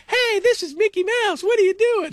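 very high squeaky cartoon mouse voice; Mickey Mouse impression. Host mocking the network owner in a squeaky Mickey Mouse voice. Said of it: "You should be an impressionist".

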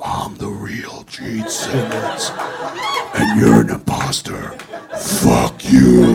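very low, drawn-out monster growl; mock demonic Gene. Costumed impostor confronting Gene, slow demonic growl. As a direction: growl it slowly like a stage demon. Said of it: "I could walk in the middle of his interview and go".

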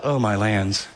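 low, dismayed mutter; dismayed exclamation. Retold or imitated. Spotting the estranged person at the airport, low dismay. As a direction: sink with dread at the sight. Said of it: "you just realize".

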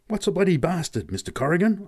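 high-pitched, tentative question; high gasped query. A narrator's reading. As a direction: ask it as a bewildered kid. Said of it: "I gasped at last".

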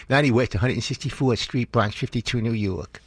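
lightly voiced little boy reading out his address; a hint of a nine-year-old on the phone; little kid voice. Boy giving his address to the telethon man, lightly kid-voiced. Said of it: "It's exactly how I sounded back then".

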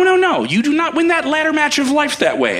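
ordinary delivery

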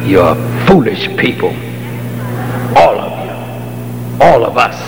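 ordinary talk

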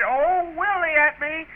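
high mimicked sister's call; mock holler. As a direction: imitate her calling out, indignantly. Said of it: "She hollered".

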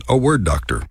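ordinary talk, nothing marked

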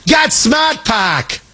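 slow shouted Boston ad line; hammed-up Boston car ad; Boston accent. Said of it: "the car commercial".

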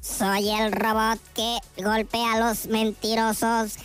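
low, slow robot monotone. Acted. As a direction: speak as a robot just switched on, word by word. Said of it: "El robot, lo enciende todo". (voz de robot grave y lenta)

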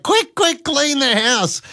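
high frantic hurry, playfully exaggerated; panicked rush to tidy before Mum arrives; squealing mock alarm.

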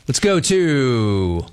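slow sports-radio host stretch; drawn-out host call. Acted. Host drawing out the call to the next city. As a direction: stretch it out like announcing the next caller.

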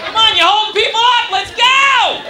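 conductor yelling. Retold or imitated. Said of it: "starts giving him shit".

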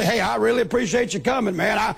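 rushed, mock-grateful thanks; hammed-up thanks, said fast; mock gushing thanks. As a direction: rattle off fake gratitude quickly. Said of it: "follow him out to the car and say".

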